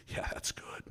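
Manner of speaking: words drop to a breathy hush; shaken whisper. Retold or imitated. Agent's voice goes to a whisper after the shock. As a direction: let the voice fall away to a whisper. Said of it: "And then his voice".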